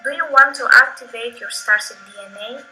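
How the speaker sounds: ordinary talk, nothing marked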